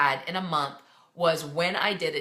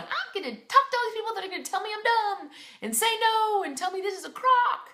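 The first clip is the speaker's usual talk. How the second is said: much higher, sing-song sarcastic cheer; mock chipper voice; 14 semitones higher than usual. Coach mocks a falsely cheerful new recruit, much higher sarcastic sing-song. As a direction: parody someone thrilled to be rejected all day. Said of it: "You will not wake up every day going".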